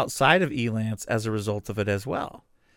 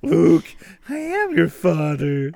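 slow, put-on villain voice; drawn-out mock-solemn reveal; Darth Vader; 4 semitones higher, slower than usual. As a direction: intone it like a movie villain. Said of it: "Darth Vader".

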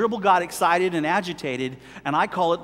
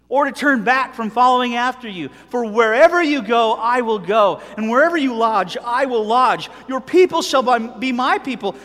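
solemn scripture reading; 4 semitones higher, 7 dB louder than usual. Acted. Preacher reads Ruth's vow to the congregation, loud and solemn. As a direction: proclaim it like sacred text to the congregation.

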